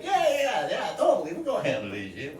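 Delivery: high, quick, laughing yeah-yeah; mock eager agreement. Nephew humoring his uncle about the fight, mock eager.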